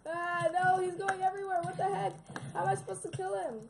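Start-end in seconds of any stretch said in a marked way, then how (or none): 0.1-2.1 frantic pleading to go away; panicked yelling. Real, not acted. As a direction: flail and beg it off. Panicking at attacking guard.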